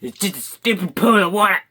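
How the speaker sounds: cartoonish loud voice; loud silly voice. Real, not acted. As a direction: squawk it out in a goofy character voice. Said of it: "I begin to do voices".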